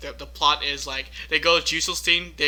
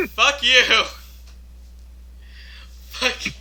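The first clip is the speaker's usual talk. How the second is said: laughing. Real, not acted. Laughing at a joke.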